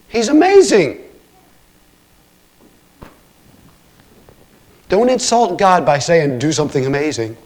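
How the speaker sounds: high, loud, exclaiming pulpit voice; impassioned preaching. Real, not acted. Preacher insisting God is already amazing, with impassioned force. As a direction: preach at full force, incredulous and adoring.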